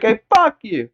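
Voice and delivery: high, put-on snotty kid voice; bratty kid. Joking as Tommy, sassing back to the babysitter. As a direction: sass back like a brat. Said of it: "He's like".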